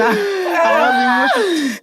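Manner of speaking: high, slow mock wail on emotional; drawn-out wail. Guest mocking her wedding self, high slow mock wail. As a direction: stretch it into a hammed-up whimper.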